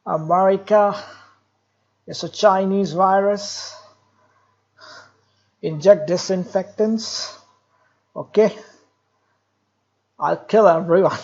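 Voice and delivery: lightly imitating Donald Trump; a hint of Trump's bluster; Trump impression. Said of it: "I've got another great man".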